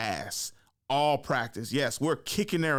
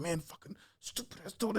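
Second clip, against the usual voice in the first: much softer, higher sulky grumble; muttered cursing; 5 semitones higher, 9 dB quieter than usual. Retold or imitated. Benched player cursing to himself, muttering resentfully. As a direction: act out grumbling under your breath.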